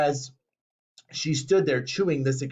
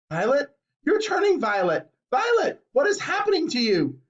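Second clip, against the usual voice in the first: high, frantic pleading; panicked cry; 10 semitones higher than usual. A narrator's reading. Mother crying out as her daughter turns violet, high. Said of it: "yelled Missus Beauregard".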